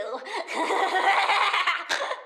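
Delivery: rising playful giggle; a mischievous little laugh. Acted. Plant girl laughs at her own jab, rising giggle.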